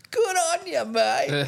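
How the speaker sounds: high loud ocker "Good on you, mate"; Marty Sheargold impression. Co-host imitates Marty Sheargold's catchphrase, high and loud. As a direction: belt it out like a broad Aussie radio bloke. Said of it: "They used to go".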